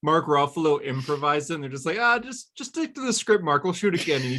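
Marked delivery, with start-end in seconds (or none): 2.0-4.2 lightly acted director, pitched high; a touch of high, placating on-set direction; imitated film crew. Film crew telling Mark to reshoot, mock placating.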